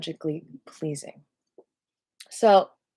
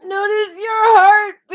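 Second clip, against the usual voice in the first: much higher, louder, slow cue; loud mock cue; 14 semitones higher, 10 dB louder, slower than usual. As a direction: belt the cue out playfully. Meditation cue, suddenly loud.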